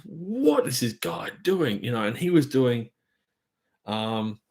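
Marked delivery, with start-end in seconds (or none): 0.1-1.8 stunned, drawn-out disbelief, pitched up; amazed, higher and slow. Retold or imitated. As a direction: slowly marvel at the drummer, voice lifted. Watching a drummer in the park.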